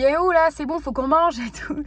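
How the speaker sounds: loud mock yell at dinnertime; retold yelling. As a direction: shout it like calling for dinner. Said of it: "à gueuler".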